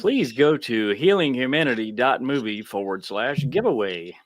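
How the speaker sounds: ordinary talk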